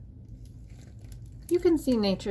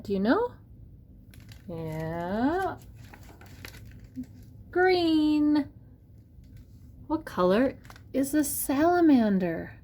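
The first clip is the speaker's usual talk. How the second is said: bright, lively picture-book reading; reading to a toddler; 6 semitones higher than usual. Acted. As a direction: ask the kids each question with wonder.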